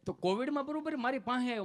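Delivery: ordinary talk, nothing marked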